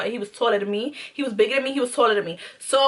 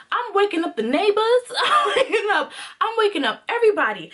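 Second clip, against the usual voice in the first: high-pitched excited recounting of a rant; retold screaming; 8 semitones higher than usual. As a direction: play up the commotion, pitched high. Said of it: "I'm in here, I'm screaming".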